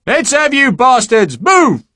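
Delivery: loud, slow bellowed order; drill shout. Acted. Corporal bellowing at the recruits to move, slow and loud. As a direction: drive the recruits forward.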